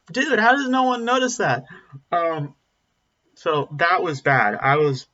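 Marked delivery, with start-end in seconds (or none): 0.1-1.7 much higher, louder disbelief; incredulous outburst. Retold or imitated. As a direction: throw it out like you can't believe nobody caught it. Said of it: "I'm like".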